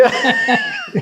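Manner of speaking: very high squealing laugh. Real, not acted. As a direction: burst out in a high-pitched laugh. Laughing at his own impression.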